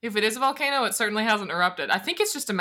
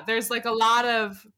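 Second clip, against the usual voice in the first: ordinary talk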